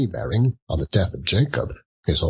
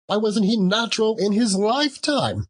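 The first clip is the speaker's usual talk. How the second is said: indignant shriek; 14 semitones higher than usual. A narrator's reading. Indignant peak.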